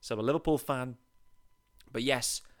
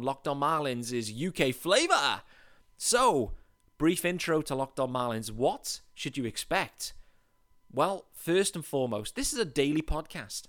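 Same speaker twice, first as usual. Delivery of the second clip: normal talking voice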